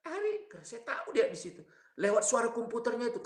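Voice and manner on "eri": high frantic call, rising higher. Retold or imitated. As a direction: cry the name out in alarm. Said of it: "Maka saya teriak". (teriakan panik bernada tinggi)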